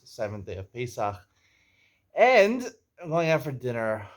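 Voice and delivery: ordinary delivery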